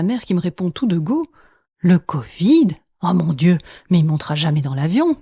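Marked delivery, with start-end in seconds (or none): 1.8-5.2 loud alarmed outburst, "covid" rising; mock alarm. Grandmother hears Félix may have covid, mock alarm. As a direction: play up a grandmother's panic, louder.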